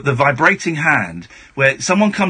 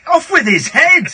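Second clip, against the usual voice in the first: hammed-up high, loud decree, slowed; mock royal decree; 12 semitones higher, 5 dB louder than usual. Host mocking Trump for walking ahead of the Queen, hammed-up decree. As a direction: bellow it like a pantomime queen.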